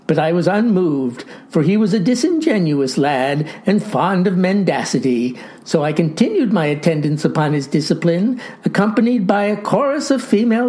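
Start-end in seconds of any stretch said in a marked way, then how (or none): none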